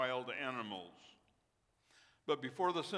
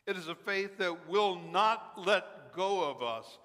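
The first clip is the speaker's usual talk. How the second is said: preaching; 4 semitones higher, 6 dB louder than usual. Acted. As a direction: build the sermon with force. Preaching at full force.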